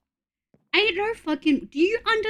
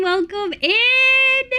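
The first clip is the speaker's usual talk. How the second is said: drawn-out excited welcome; 11 semitones higher, 4 dB louder, much slower than usual. Real, not acted. Greeting two returning viewers.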